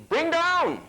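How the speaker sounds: high, drawn-out teacher's call; mock teacher command. Teacher giving children the division step, high mock command.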